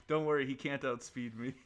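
ordinary delivery

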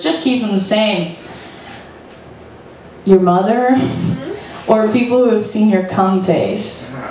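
normal talking voice

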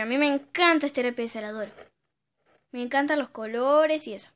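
ordinary talk, nothing marked

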